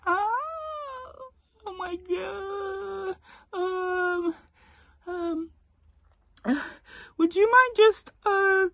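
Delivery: very high, breathy, hesitant falsetto with long pauses; exaggerated polite coworker. Shown as a demonstration. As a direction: squeak it out as an over-apologetic colleague. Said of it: "There was the timing... eyes closed".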